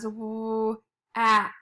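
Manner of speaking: "w-, at" split into a held w, then at; sounded out in parts. Shown as a demonstration. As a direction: sound out "w-, at" slowly in two pieces. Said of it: "should be pronounced as".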